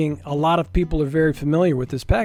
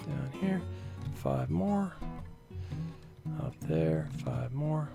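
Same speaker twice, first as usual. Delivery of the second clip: much softer, under the breath; quiet running commentary; 2 semitones lower, 12 dB quieter than usual. Real, not acted. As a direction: count along quietly to yourself while busy. Murmured self-narration while clicking through spawns.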